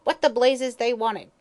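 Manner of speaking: slow, loud, high angry demand; an old hag demands what they want; vicious demand. Acted. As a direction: snarl it out at the intruders. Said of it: "she eyed them viciously, demanding".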